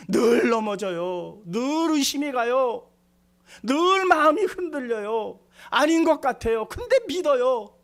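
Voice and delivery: fervent sermon climax; preaching at full force. Acted. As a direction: pound out each line to the congregation.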